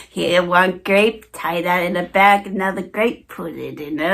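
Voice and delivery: dawdle over each grape. Retold or imitated. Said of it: "packing individual grapes".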